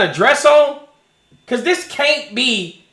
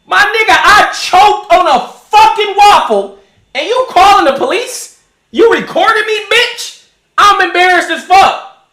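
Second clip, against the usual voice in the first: indignant rant; 5 semitones higher, 4 dB louder than usual. Retold or imitated. Ranting at imagined recorder.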